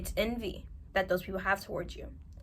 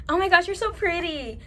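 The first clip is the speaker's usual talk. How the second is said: fake sweet compliment; 7 semitones higher, 5 dB louder than usual. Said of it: "People who go from".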